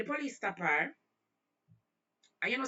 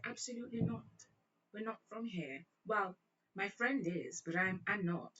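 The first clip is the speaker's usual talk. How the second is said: put-on British accent; 3 semitones higher than usual. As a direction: deny it in dainty mock British. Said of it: "broke in a one British accent".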